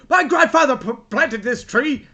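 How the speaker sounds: loud, high mock outrage; hammed-up shouting of an aggrieved man; outraged tree owner. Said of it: "the guy comes out and he's like".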